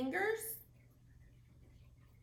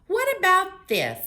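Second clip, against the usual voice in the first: high, loud eager question; excited offer; 9 semitones higher, 16 dB louder than usual. Acted. Baby offering Gibbles a pickle, high and eager. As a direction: hold it out eagerly.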